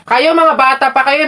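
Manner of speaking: ordinary talk, nothing marked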